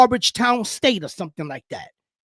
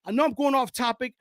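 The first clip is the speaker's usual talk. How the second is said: ordinary talk, nothing marked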